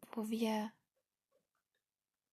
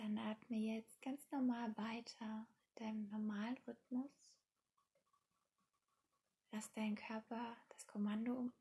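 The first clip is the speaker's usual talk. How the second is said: guided meditation; 8 dB quieter than usual. Acted. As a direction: ease the listener into calm, softly.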